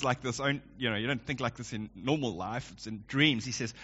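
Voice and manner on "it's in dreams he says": ordinary talk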